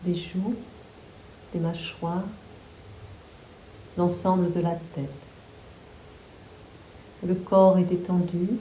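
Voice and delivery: calm, unhurried yoga nidra guidance; guided meditation. Real, not acted. Yoga teacher guiding listeners to relax the whole body, guided meditation. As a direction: guide them through the body, phrase by phrase with long pauses.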